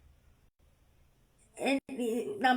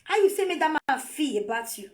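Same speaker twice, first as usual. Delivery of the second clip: ordinary delivery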